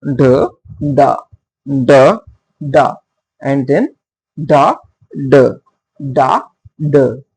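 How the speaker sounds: the, da, chanted with a strong first beat; DUM-da rhythm. Shown as a demonstration. Teacher chanting the trochaic beat to the class. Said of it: "the is stressed".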